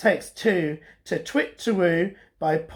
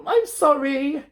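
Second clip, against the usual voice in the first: sad apology; 8 semitones higher than usual. Acted. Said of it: "said Olive sadly".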